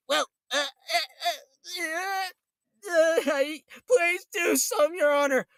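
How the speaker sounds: hammed-up tearful begging to the judge; mocking the triggered hater crying to the judge; mock crybaby.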